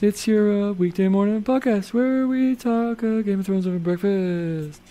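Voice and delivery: put-on podcast host voice; a different voice for the intro; changed intro voice. Shown as a demonstration. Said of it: "I just gotta change the voice".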